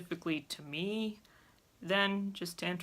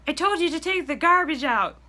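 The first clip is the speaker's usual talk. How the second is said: much higher, louder mock scolding; scolding mom; 11 semitones higher, 11 dB louder than usual. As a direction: imitate mom telling off her kid. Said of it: "She'd go".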